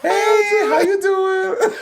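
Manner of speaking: very high, drawn-out mock greeting; imitation in a tiny high voice; Little People of Atlanta meme voice. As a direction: squeal it slowly, way up high, like the meme. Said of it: "the meme from Little People of Atlanta".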